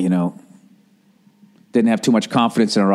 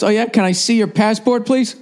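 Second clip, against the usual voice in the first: higher, sarcastic official voice; mock customs officer; 12 semitones higher than usual. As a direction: quiz her like a smug border guard. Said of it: "I wanted to blow up".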